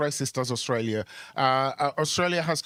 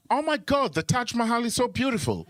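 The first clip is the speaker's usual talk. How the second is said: much higher excited squeal; mock gushing; 8 semitones higher than usual. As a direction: gush with clueless delight. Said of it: "acted Aussie reply".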